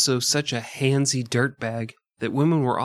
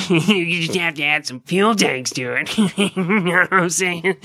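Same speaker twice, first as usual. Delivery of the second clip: nerd voice; 4 semitones higher, 4 dB louder than usual. Acted. Mocking the MIT nerd.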